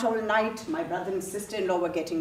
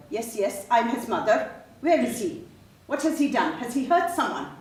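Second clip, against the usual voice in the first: higher, louder, urgent questions; frantic worry; 5 semitones higher, 4 dB louder than usual. Acted. Mother questioning two police officers about a son, frantic. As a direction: press them anxiously for answers.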